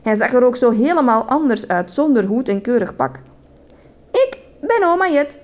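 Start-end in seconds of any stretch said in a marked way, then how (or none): 4.1-5.4 high-pitched granny character voice; put-on high voice for oma; grandmother figure. A narrator's reading. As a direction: introduce yourself as a perky storybook granny. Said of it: "zei oma Jet".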